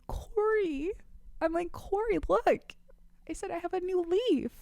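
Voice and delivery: high, excited squeal of delight; gushing happy. Retold or imitated. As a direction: gush over the new leaf like a thrilled kid. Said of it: "so happy".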